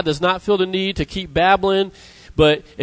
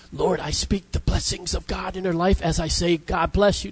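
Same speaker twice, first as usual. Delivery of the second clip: hushed, much softer pretend prayer; softly imitating solemn, heartfelt prayer; mock profound prayer; 6 dB quieter than usual. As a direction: murmur it like a deeply meant inward blessing. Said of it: "sitting there profoundly thinking".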